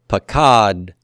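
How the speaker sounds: slow pakad, pause, released t; pa-KAAD...t, pakad spaced out; separate final T sound. Shown as a demonstration. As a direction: model pakad with a detached t after the d. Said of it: "Say that with me".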